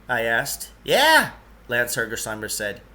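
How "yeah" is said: put-on lightly raised, eager odd old fellow; a hint of a fussy eccentric guide; Lance's voice. Lance hurrying the two boys along, lightly higher voice.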